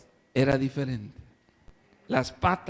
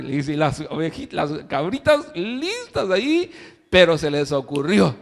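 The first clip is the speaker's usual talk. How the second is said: ordinary talk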